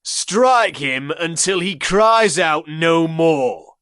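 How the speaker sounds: loud, high, slow order; cruel command. Acted. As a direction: demand it with cold cruelty. Gaius orders more lashes.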